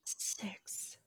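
breathless whisper. Real, not acted. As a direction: whisper it in disbelief. Echoing the number in awe.

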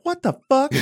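sudden high disbelieving exclamation; baffled outburst. Retold or imitated. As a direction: blurt it out, stunned. Reacting to British actors' accents.

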